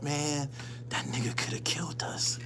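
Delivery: hushed whisper. Retold or imitated. Two scared boys whispering about the fighter. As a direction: whisper it like he might overhear.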